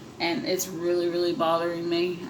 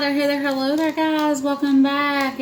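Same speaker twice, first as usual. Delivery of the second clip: excited greeting; 9 semitones higher, 6 dB louder than usual. Real, not acted. Sing-song vlog greeting.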